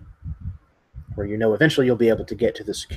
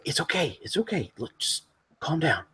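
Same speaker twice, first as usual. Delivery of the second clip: higher, softer soothing voice; calming; 5 semitones higher, 7 dB quieter than usual. Acted. Survivor quietly calming his panicked companion. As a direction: hush a frightened friend. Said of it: "He's like".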